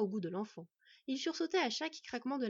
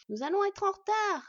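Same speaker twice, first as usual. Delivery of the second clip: high, loud urgent little voice; impatient child; 11 semitones higher, 9 dB louder than usual. A narrator's reading. Boy nagging the wolf to hurry, high and urgent. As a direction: press them to hurry, childlike. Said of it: "ajoutait-il parfois".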